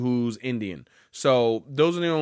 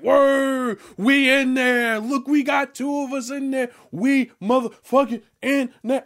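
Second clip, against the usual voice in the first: imitated celebrating; 9 semitones higher, slower than usual. Imitating fans celebrating characters in a game, high exuberant cheering. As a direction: whoop it up like a celebrating crowd. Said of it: "a bunch of people celebrating".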